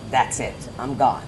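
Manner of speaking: normal talking voice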